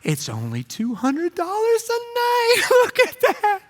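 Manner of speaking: high, wide-eyed, slow and lilting; naive excited tourist. Retold or imitated. Wisconsin tourists admiring a cheap listing, high and thrilled. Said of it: "people from Wisconsin".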